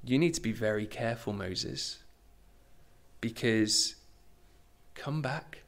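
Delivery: soft, menacing warning sinking to near-whisper; calm gangster threat, spaced with pauses; quiet threat. Acted. Pharaoh warning Moses not to come back, quietly menacing. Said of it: "it's pretty threatening, right?".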